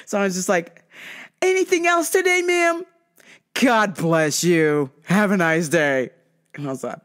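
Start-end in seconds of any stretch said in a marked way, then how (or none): 1.4-2.8 high, sugary service voice; overly courteous retail worker, pitched up. Retold or imitated. 3.5-6.1 very drawn-out customer-service farewell; sugary send-off. Retold or imitated. Teller's exaggerated polite send-off to customer.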